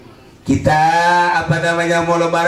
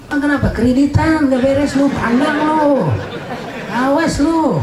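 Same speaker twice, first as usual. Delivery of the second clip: high, taunting scold, jeering at the sinner; jeering Betawi taunt, pitched high; mocking hell voice; 8 semitones higher than usual. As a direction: play hellfire mocking someone who owes money.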